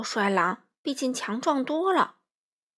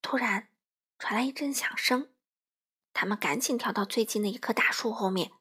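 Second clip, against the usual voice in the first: plain reading voice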